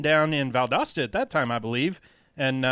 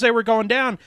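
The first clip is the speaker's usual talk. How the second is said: normal talking voice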